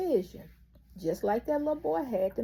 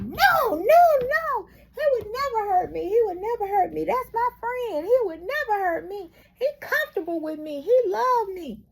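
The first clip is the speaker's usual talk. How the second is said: high, loud, pleading denial; boy's desperate protest; 10 semitones higher, 9 dB louder than usual. Retold or imitated. Little boy protests the doctor's warning, high and loud. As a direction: cry out no like a boy who won't believe it.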